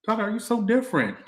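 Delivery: high mock-surprised fan voice; imitating fans. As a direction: squeak it out like a startled fan. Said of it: "They go".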